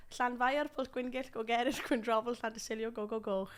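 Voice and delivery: "Llanfair" with the Welsh hissed Ll; Welsh place name. Shown as a demonstration. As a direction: recite "Llanfair" to "Go Go Goch" in Welsh sounds. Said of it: "do the Welsh".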